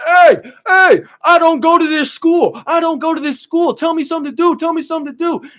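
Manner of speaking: high, loud, strained yell of a wild intruder; hammed-up shouting big bearded guy; yelling stranger. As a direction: belt it out like an unhinged dude on the stairs. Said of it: "he's just yelling the shit".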